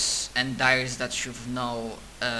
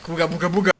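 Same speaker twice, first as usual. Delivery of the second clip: louder, higher goofy farmer yell; cartoon farmer voice; 4 semitones higher, 5 dB louder, much faster than usual. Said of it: "this farmer was like".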